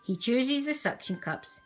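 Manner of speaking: plain reading voice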